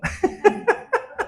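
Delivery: high airy giggle; a quick amused laugh through the breath; light breathy chuckle at his own joke. Real, not acted. Joke about self-indulgence.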